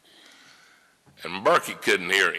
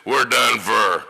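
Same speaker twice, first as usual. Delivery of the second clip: slow, louder mock resignation; old lawyer resigned; 4 semitones higher, 7 dB louder, slower than usual. Old lawyer telling the young man they've lost, slow and resigned. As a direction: drag it out like it's all over.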